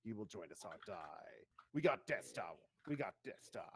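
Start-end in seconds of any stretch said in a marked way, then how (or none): 0.0-1.4 low, menacing Dark Lord rasp; Palpatine voice. Acted. Palpatine-style Sith voice, Star Wars. 1.7-2.5 loud, punchy delivery on got. Acted. Same line said three ways.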